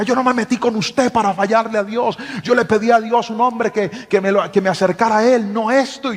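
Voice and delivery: high-pitched mock upset protest; imitating tearful girlfriend. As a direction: playfully mimic her tearful complaint. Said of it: "le daba rabia y empezaba a llorar". (imitando a la novia llorosa, aguda)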